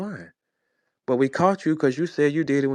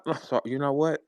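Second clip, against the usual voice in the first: normal talking voice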